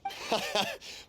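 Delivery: amused chuckle. Acted. Shopkeeper chats amiably.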